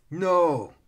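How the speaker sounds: high, loud cry of refusal; narrator's full-voiced villain scream. A narrator's reading. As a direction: scream it out in rage. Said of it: "Keris screamed".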